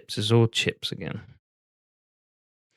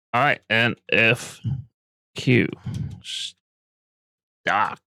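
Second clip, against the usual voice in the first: spelled-out dictation; much slower than usual. Real, not acted. Spells ticker to the assistant.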